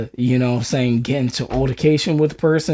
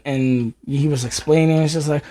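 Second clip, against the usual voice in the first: ordinary delivery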